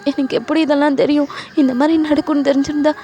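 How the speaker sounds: high, distressed acted wail; upset, pleading self-defence; tearful protest. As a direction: protest helplessly as if crying. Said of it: "அப்படின்னு சொல்லிட்டு நீங்க ரொம்ப அழுவுறீங்க".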